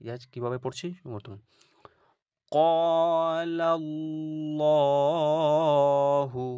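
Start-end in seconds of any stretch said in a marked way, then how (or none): 2.5-6.5 ক্বালাল্লাহু drawn out very slowly, higher and louder, heavy ল; recited heavy. Shown as a demonstration. A Quran teacher to students, reciting a heavy example. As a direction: chant ক্বালাল্লাহু with a full ল. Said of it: "ল পড়ছি".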